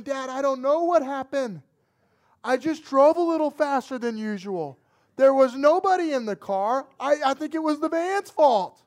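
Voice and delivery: loud, high-pitched protest to Dad; frantic lying. Retold or imitated. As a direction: plead your made-up excuse at full volume. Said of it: "I'm lying straight through my teeth".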